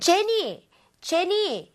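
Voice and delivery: calling out. Acted. Calling out to Jenny.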